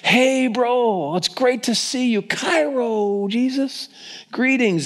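high-pitched, effusive hello to a pal; hammed-up delighted welcome; chummy greeting. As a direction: hail a buddy, pitch held way up. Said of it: "You'd greet someone with".

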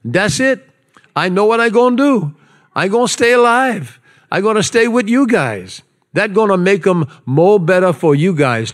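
high, animated Hawaiian Pidgin; singsong Hawaiian Pidgin reading, pitched well up; Hawaiian Pidgin accent. Acted. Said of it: "in my best, uh, Pidgin accent".

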